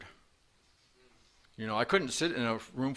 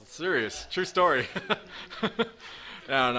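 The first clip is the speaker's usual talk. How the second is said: loud, high, laughing insistence; 7 semitones higher, 3 dB louder, slower than usual. Real, not acted. Speaker assuring the room the hamburger joke is true, laughing. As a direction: insist on it through a burst of laughter. Joking about friends stealing his hamburger.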